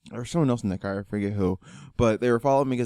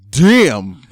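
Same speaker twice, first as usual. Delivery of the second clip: retold shocked reaction; 2 semitones higher, 11 dB louder than usual. Reacting to the overturned truck.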